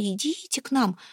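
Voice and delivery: soft mock beckoning call; luring call. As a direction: lightly voice the luring women. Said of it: "Они нас звали".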